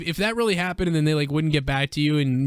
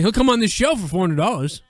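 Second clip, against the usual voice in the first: ordinary talk, nothing marked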